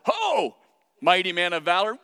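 loud, startling call from behind; booming call. Acted. God startling a frightened Gideon, loud booming call. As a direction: boom it out to startle him.

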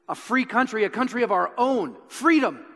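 rousing call. Retold or imitated. Wallace rallying the nobles, rousing loud call. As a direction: lift them up to freedom. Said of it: "He says".

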